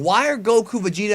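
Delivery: ordinary talk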